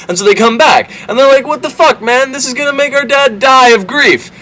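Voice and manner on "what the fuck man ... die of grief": high, whiny protest; a hammed-up panicked whine, pitched way up; mock distress. Brothers pleading that their dad will die, in a high whine.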